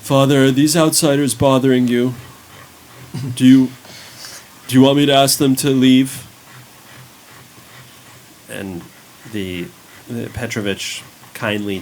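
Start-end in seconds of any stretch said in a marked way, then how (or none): 0.0-6.3 put-on louder, fast, wary young man; protective young man. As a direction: challenge the strangers protectively. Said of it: "the young man on the ladder turns around and says".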